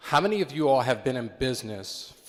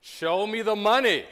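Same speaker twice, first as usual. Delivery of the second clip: slower, high, loud call; catchphrase again; 10 semitones higher, 4 dB louder, slower than usual. Pretend. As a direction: drag it out to rouse the room. Repeats catchphrase, crowd weak.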